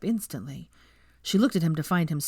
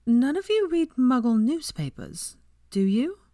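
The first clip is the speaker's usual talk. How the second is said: higher, slow and hesitant; tentative; 8 semitones higher, 3 dB louder, slower than usual. A narrator's reading. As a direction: ask carefully, half afraid of the answer. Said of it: "in a tentative voice".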